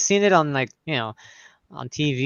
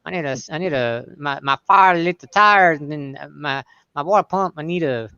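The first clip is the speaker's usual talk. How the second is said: put-on slurring old Southern men. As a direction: slur it like an old country customer. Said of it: "they slur it bad".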